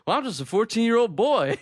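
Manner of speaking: high, loud mock boy voice; grown man as a boy. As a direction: pitch it way up like a grown man playing fourteen. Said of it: "He's like".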